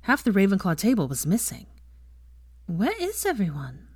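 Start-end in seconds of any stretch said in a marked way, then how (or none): 2.7-3.9 lightly puzzled, raised-pitch question; curious. A narrator's reading. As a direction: ask with innocent curiosity. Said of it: "she asked curiously".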